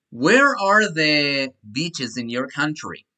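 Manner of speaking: very slow, word by word; teacher dictating an English question; slow dictation. Shown as a demonstration. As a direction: spell it out slowly for students. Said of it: "teacher reads sentence to class".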